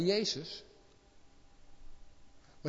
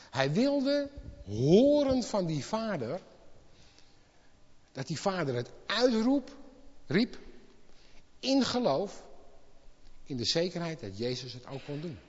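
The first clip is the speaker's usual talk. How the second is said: ordinary talk, nothing marked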